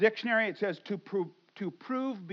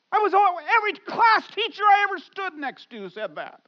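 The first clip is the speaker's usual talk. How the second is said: loud, high preaching voice; impassioned preaching; 9 semitones higher, 9 dB louder than usual. Acted. Pastor confessing his old shame to the church, loud preaching. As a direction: pour it out like a confession from the pulpit.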